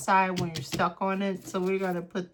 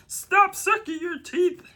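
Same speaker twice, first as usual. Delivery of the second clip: mock scolding; 11 semitones higher, 7 dB louder than usual. Mock scolding herself for teeth sucking.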